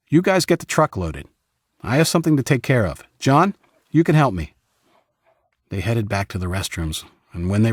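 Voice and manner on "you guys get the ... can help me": put-on high, louder, confident young leader; cheerful voice for a friendly leader. Sonny gives orders.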